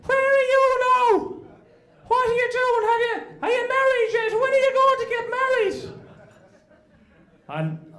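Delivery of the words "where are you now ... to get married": nosy aunt voice. Retold or imitated. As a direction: fire questions like a machine gun, high and shrill. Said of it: "straight in like an AK forty-seven".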